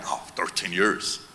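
low, soft, drawn-out complaint; mock weary groan. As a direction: sag into it as if the wait is endless. Mimics objection that it's too long.

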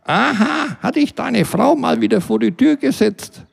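a nosy neighbour mocking him; neighbour Britta. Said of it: "Sie meldete sich".

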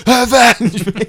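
high, hammy dying shout; overacted death scene. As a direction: bellow it like a melodramatic dying hero. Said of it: "it's like".

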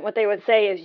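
ordinary talk, nothing marked

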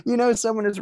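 words squeezed out between giggles; stifled giggles. Real, not acted. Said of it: "I'm trying not to laugh too hard".

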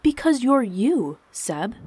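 slow, high, emphatic explanation; as if obvious. A narrator's reading. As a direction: stress it like it should be obvious. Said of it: "responded as if it was obvious".

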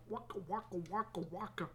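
light playful game-sound imitation; Pac-Man waka. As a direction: mimic the arcade chomp. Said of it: "sounds like Pac-Man eating pellets".

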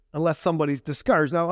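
ordinary delivery

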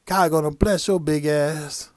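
loud, slow, drawn-out blessing with a grin; panhandler's blessing. Retold or imitated. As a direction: bellow the compliment diss proudly. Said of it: "You know what this motherfucker said?".

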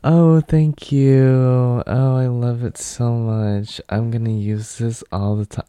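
high, slow, overdone thank-you; fake gratitude. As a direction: gush with phony thanks. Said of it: "I didn't see any fake".

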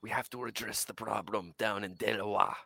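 low, hushed gangster order; hint of a menacing crime boss; mock mob boss. Pretend mob boss assigning a job, quietly menacing.

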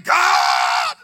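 long, loud shout stretched on one word; bitter cry out. Retold or imitated. Preacher voicing a man crying to God, bitter shouted plea. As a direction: re-enact a desperate plea, drawn out at full force. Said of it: "this man cried bitterly".

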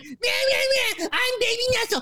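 put-on very high, wailing baby whine; mocking crybaby. Arguing host mocks his co-host's objection, high crybaby wail. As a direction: mimic your opponent as a bawling whiner.

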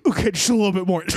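mock-exhausted pep talk after a gasp; straining self-coaxing. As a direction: act out hauling yourself upstairs. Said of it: "talking my way".

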